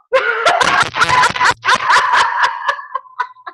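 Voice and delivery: high squealing laugh; long, high laughing fit; helpless laughter. Real, not acted. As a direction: crack up completely. Laughs at holding it in.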